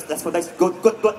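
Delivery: put-on low, approving Persian-accented "good, good, good"; lightly imitated Iranian dad's approval. Dad approving his son facing the bully, Iranian accent. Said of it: "that's how we talk".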